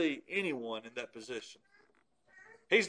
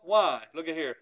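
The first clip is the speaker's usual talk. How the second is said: normal talking voice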